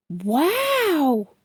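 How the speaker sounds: put-on a small boy's gasped wow; childlike wide-eyed wow; amazed gulp. A narrator's reading. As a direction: gulp it out in wonder. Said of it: "gulped Daniel".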